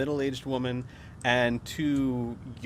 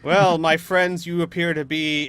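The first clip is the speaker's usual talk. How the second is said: villain shouting; 5 semitones higher, 9 dB louder than usual. Acted. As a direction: call out grandly to hidden foes. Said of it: "you hear this shouting by now".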